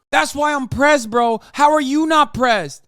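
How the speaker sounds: angry yelling at a viewer; angry shout. Real, not acted. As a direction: snap back at chat. Streamer shouting at chatter.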